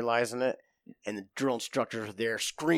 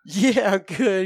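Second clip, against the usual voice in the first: higher, slow, stretched mock-innocent reply; 8 semitones higher, 8 dB louder, much slower than usual. Prankster answering his scared buddy, mock innocent. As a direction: play it cool while holding back laughter.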